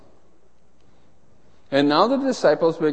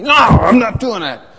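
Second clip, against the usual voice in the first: loud, obstinate no; stubborn refusal; 6 semitones higher, 7 dB louder than usual. Retold or imitated. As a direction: refuse flatly and loudly. Customer refusing again.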